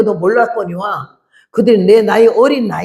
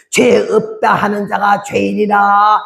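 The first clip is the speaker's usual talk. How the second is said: drawn-out open-mouthed pronouncement; slow imitation of an involuntary utterance; slow, mouth wide; slower than usual. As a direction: say it slowly, stretching the vowels. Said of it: "입을 막 이리 벌리면서 천천히 말을".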